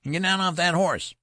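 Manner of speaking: loud, very high, ferocious command. A narrator's reading. Boy general orders the colonel off his horse, ferocious. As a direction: furiously order him down in a boy's treble. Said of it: "retorted Benjamin in a ferocious voice".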